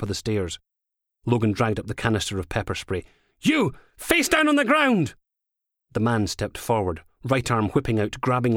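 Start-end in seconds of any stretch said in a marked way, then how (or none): 3.4-5.1 high, forceful police shout; shouted command. A narrator's reading. Officer with pepper spray at suspect, shouted command. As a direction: order a suspect down at full pitch.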